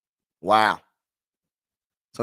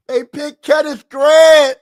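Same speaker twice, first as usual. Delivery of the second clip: slow, loud, high disbelief; shouting it out in shock; real disbelieving shout; stunned shout; 7 semitones higher, 7 dB louder, slower than usual. Real, not acted. Repeating the disappointing pick.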